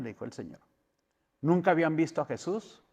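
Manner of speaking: ordinary talk, nothing marked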